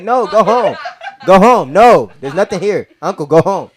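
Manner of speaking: high, loud, laughing shout to shoo people off; mom yelling go home. Retold or imitated. Mom shouting at a lingering uncle to go home. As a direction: yell them out the door, half laughing. Mom shooing late party guests.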